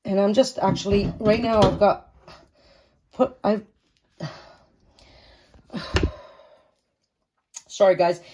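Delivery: breathless, hot and tired; out of breath. Real, not acted. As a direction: talk while out of breath. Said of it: "I'm out of breath".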